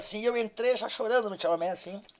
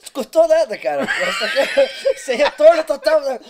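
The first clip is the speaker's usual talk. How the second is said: laughing while talking; 5 semitones higher, 8 dB louder than usual. Real, not acted. Laughing at the grandma story.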